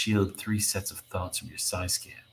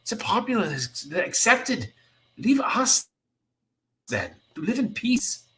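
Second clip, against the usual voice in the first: bitter outburst; 9 semitones higher than usual. Acted. Nightcrawler rejects the X-Men.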